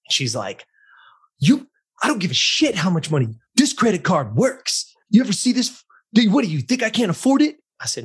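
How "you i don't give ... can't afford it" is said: high, loud, indignant rant; angry client. Retold or imitated. Trainer voicing an offended client, loud and indignant. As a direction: throw the credit card in his face, offended. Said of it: "And she got pissed".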